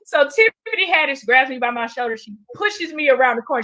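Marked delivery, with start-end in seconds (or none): none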